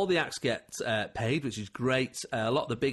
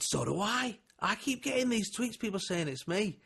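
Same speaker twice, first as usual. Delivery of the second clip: imitating Alfie; 9 semitones higher than usual. Voices Alfie on the phone.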